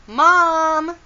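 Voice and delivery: loud, high, drawn-out call. A narrator's reading. George calls to her mother, calling out high and loud. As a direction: call for a parent in another room. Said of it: "George called".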